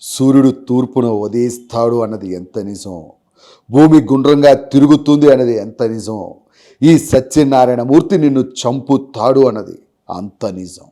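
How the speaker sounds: slow, booming film-hero dialogue; Balakrishna impression. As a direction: deliver it like a mass hero's threat, slow and heavy. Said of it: "Balakrishna గారిది కదా".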